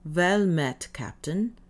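usual speaking voice

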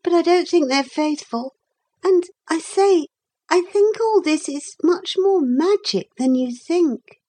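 put-on high, earnest girl; young girl character. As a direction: play a little girl confiding her theory. Narrator voices girl Lucy.

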